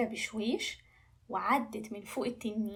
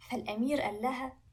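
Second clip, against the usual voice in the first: ordinary delivery, nothing marked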